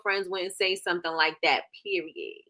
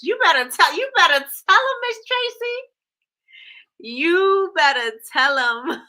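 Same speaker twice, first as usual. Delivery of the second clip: hyped cheering; 9 semitones higher, 5 dB louder than usual. Real, not acted. Cheering Miss Tracy after she answers a commenter, high and loud.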